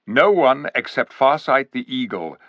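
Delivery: plain reading voice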